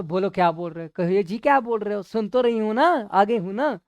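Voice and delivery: a hint of the impatient wife, rising; wife snapping back, lightly voiced. Wife tossing the blowpipe and asking husband what he wants.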